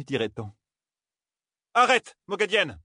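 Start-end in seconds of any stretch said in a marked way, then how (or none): 1.7-2.8 high, sharp battle cry; shout. A narrator's reading. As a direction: call out to stop her. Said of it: "cria soudain Birgit".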